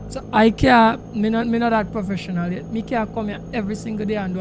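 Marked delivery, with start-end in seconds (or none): none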